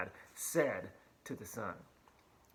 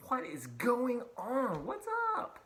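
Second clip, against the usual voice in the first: higher, slow indignant demand; mock-angry big brother, pitched up; furious older brother; 9 semitones higher, slower than usual. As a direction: play the outraged older son. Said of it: "he was furious and he's like".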